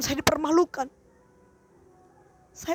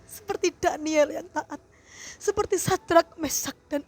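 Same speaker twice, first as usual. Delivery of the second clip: higher, louder tearful prayer, pleading with God; fervent prayer; 6 semitones higher, 5 dB louder than usual. Real, not acted. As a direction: pour it out to God, voice near tears.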